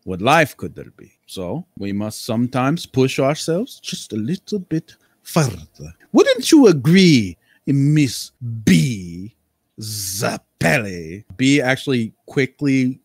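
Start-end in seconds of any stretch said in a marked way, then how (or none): 0.0-11.2 eccentric nobleman =1. Acted. Lord Cambria speaks.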